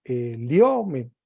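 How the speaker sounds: slow "eliomen", stressed on the O; stress on second-last syllable. Shown as a demonstration. Teacher repeats for attention, slow stressed O in "eliomen". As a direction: stretch the O of "eliomen". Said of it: "Atención".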